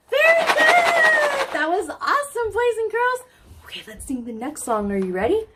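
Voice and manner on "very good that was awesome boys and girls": excited praise. Real, not acted. Praising children after song.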